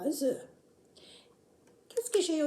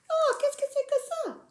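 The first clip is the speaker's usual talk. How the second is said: surprised puppet; 12 semitones higher, faster than usual. Acted.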